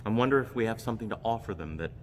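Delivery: ordinary talk, nothing marked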